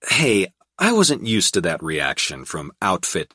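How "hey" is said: ordinary delivery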